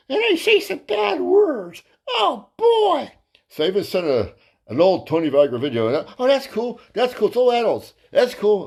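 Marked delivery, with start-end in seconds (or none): 0.1-3.1 very high, slow squeaky warning; squeaky mock voice. 6.2-8.6 lightly raised, chirpy client voice; high mock voice. Voicing the client's eager reply.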